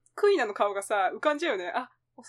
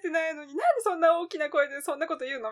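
exasperated complaint; 6 semitones higher, 3 dB louder than usual. Acted. Voicing exasperation at noisy fans.

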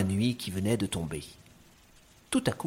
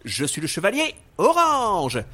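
heroic declaration; 8 semitones higher, 6 dB louder than usual. Acted. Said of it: "Alors, je reprends".

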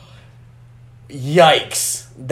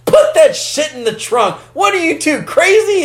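much higher, louder sheriff yell; mock shout; 11 semitones higher, 3 dB louder than usual. Imitating the sheriff yelling at the boys, rising mock shout. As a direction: yell it in disbelief, climbing up. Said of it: "I'll turn around".

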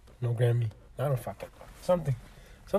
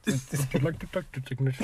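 soft, put-on Indian accent; 8 dB quieter than usual. Demonstrating to friends a call-centre agent, mock Indian accent. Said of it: "The Indian accent?".

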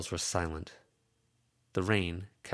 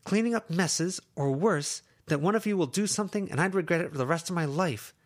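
put-on higher young woman's voice, frustrated and pleading; Lucy's voice; 11 semitones higher, 4 dB louder than usual. Lucy scolding her two friends, higher frustrated voice.

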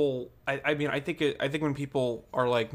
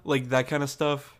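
usual speaking voice

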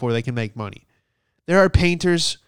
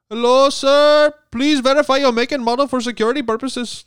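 imitating scam caller; 12 semitones higher, 6 dB louder than usual. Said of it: "that's exactly how he sounded".

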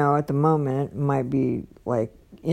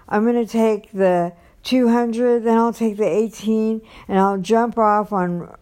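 higher, eagerly rattled-off plan; excited retelling; 6 semitones higher than usual. Retold or imitated. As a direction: imitate him excitedly laying out the route. Retells his eager travel plan.

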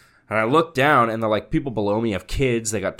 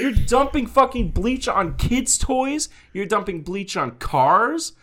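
retold yelling; 11 semitones higher than usual. Podcaster retelling how he told off the bleach dumper, yelling. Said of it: "I yelled at him".

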